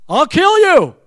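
high, loud mock threat. Dad warning son about dents, loud mock threat. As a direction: belt it out with KILL swooping up.

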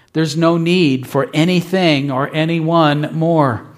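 ordinary talk, nothing marked

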